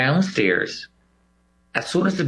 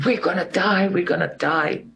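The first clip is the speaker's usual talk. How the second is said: higher, mock panicked cry; panicked screaming; 7 semitones higher than usual. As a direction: imitate the crowd shrieking in fear. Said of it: "Everybody is screaming".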